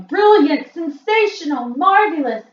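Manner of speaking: high, loud cheering witch crowd; witches cheering. A narrator's reading. Witches cheer the Grand High Witch, high and loud. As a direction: hail the genius with wild applause. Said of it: "clapping madly and shouting".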